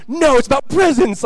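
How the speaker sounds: put-on high, loud childish insistence; mimicked kid's shouted correction; imitated young son. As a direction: protest like an adamant four-year-old. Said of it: "He's like".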